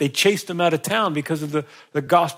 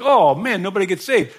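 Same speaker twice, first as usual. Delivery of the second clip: mock despair; 6 semitones higher, 7 dB louder than usual. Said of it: "it's almost like".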